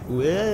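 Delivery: rising startled whoa; a quick panicked zombie cry; panic zombie noise. Shown as a demonstration. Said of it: "That's my panic zombie noise".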